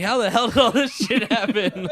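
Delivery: stunned laugh at the wreck; laughing disbelief. Retold or imitated. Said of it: "I'm like".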